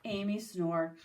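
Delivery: ordinary delivery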